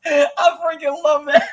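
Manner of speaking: high, loud words through laughter; real delighted laughing voice; laughing while talking. Real, not acted. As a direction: gush while cracking up.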